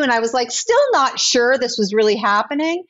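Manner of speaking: ordinary delivery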